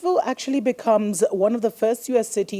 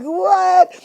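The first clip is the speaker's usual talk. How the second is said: high, loud, hyped-up disbelief; astonished reaction; 12 semitones higher, 7 dB louder than usual. Acted. Host reacting to North Carolina news, loudly astonished. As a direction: play up the amazement, pitch soaring.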